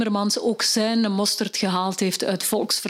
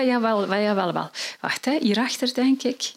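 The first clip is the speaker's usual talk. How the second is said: usual speaking voice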